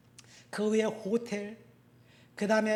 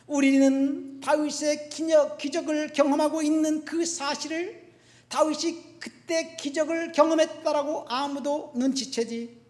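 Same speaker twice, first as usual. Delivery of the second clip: loud, high preacher's delivery; raised, emphatic sermon voice; forceful preaching; 6 semitones higher, 8 dB louder than usual. Real, not acted. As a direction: drive it home to the congregation.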